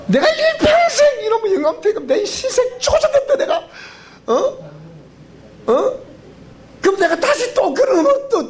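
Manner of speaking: a Gyeongsang grandma cackling over her stubborn husband; Gyeongsang dialect grandma. Retold or imitated. Old woman telling the seminar why she'd remarry him, in Gyeongsang dialect, laughing and shouting. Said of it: "우리 경상도 버전으로 얘기하겠습니다".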